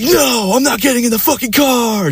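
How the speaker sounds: very high imitation of her refusal; mock screaming. Girlfriend refusing to get in the car, mock screaming. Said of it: "Just screaming her ass off".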